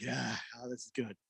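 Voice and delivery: very soft, blissed-out dog voice; imitating contented dog. Host voices his dog getting belly rubs, softly contented. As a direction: murmur it like a dog enjoying a belly rub. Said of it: "He's like".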